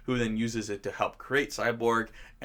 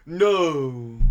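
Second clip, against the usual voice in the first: louder but lifeless mock no; bored no; 5 dB louder than usual. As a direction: toss off the no like you don't care. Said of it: "he's, like, bored".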